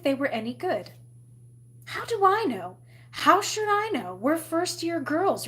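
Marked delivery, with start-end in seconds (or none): 1.9-5.5 high-pitched, defensive protest; protest, voice pitched well up; exasperated. A narrator's reading. Galinda brushes off Elphaba's questions, exasperated.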